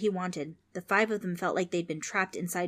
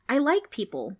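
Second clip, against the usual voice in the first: put-on pleading girl; 6 semitones higher, 6 dB louder than usual. Trix pleads.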